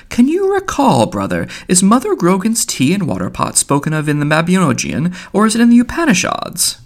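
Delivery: high-pitched, affectedly puzzled questioning; fine puzzled voice. A narrator's reading. Mulligan consulting Stephen on scripture, mock puzzled. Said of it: "asked in a fine, puzzled voice".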